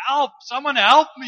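loud, high cry for rescue from a cliff; desperate shout. Acted. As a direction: shout for help at full voice, pitched high. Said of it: "The only thing you could do was shout".

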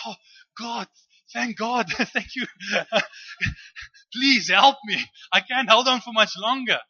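loud, high begging with relieved laughs; desperate pleading. Acted. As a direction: plead at full voice to be rescued. Hanging man begs God for rescue.

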